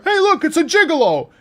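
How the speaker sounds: put-on high, very loud old-man heckle; old man's loud "whisper". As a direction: imitate an old man whose whisper is really a yell. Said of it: "old man whisper, which is loud as fuck".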